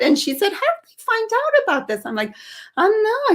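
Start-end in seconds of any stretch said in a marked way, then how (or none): 0.5-2.1 high-pitched imitation of a suspicious mother; questioning mother. Mother asking daughter about the newspaper article, suspicious. 2.8-3.3 high-pitched mock-innocent reply; feigned innocence. Daughter hiding from mother that she sent clippings, feigning innocence.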